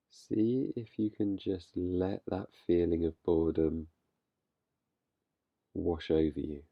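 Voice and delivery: calm, unhurried guiding voice with long pauses; guided meditation. Real, not acted. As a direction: gently lead listeners into stillness, leaving space between phrases. Leads a meditation.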